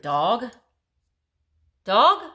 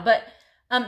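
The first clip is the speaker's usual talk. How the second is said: usual speaking voice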